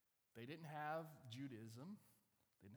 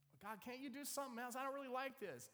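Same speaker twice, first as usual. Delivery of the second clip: high, hurried, mock-worried voice; whining plea; 8 semitones higher, 4 dB louder than usual. Man on the cliff asking God for alternatives, high and nervous. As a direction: wheedle nervously upward.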